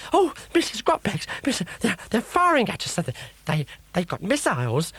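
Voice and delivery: high, stammering panic; a frightened sidekick babbling in fear; panicky crocodile. A narrator's reading. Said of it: "said Croc".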